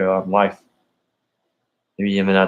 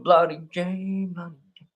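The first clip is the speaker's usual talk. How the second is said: put-on Southern accent; 9 semitones higher, 5 dB quieter than usual. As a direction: draw the name out in a Southern accent. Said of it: "Sweet Tea's Southern accent".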